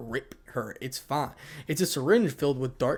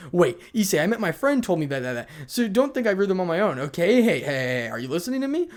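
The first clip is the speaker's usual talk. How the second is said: higher, louder protest; flustered denial; 5 semitones higher, 5 dB louder than usual. Acted. Embarrassed girl explaining herself to Issei, flustered protest. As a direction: insist urgently, then rise into a demand for attention.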